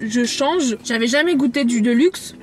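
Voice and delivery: ordinary talk, nothing marked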